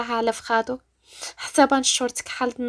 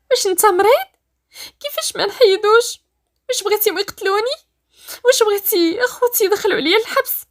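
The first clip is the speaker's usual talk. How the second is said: high, raised distraught voice; yelling tearfully; 8 semitones higher, 4 dB louder than usual. Acted. Rania fears her family will kill her, yelling in distress. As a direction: cry out panicked questions. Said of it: "ردات عليه كتغوت وتبكي".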